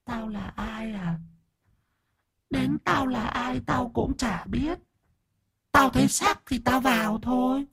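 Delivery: a leering spirit toying with him; eerie high spirit voice, slow and soft; evil spirit voice. Acted. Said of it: "nhe răng cười tà mị".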